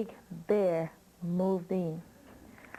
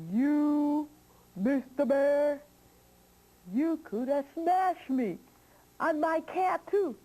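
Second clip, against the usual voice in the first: higher-pitched indignant scolding; angry old witch; 7 semitones higher than usual. Acted. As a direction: scold the bear as an outraged witch. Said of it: "She was so mad".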